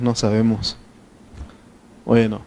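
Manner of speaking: usual speaking voice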